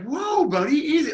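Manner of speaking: high, soothing mock calm. Heckler calming the angry fan, mock soothing. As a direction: settle him like a spooked horse. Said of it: "completely cool and just like".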